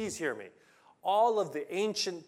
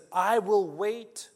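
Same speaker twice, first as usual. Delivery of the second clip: slow vow; 6 semitones higher, 9 dB louder, slower than usual. Acted. Job's vow, drawn out.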